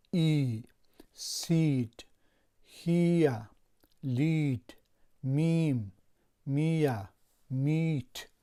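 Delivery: word list with a long ee sound, each word said loudly; teacher drilling the long ee vowel, each word clear and loud; long e vowel demo. Shown as a demonstration. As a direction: stretch the vowel in "سید" and "میت" into a long ee. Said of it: "تلفظ درازی ای دارد".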